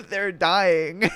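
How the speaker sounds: high wheezing laughter breaks out; can't hold the laugh in; real laughter over the words. Real, not acted. Cracking up at a post.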